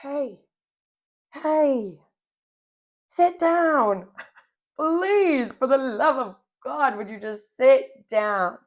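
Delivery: high, rising plea to herself; telling herself to stop and sit down, exasperated and high; exasperated self-scolding. Retold or imitated. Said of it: "I was like".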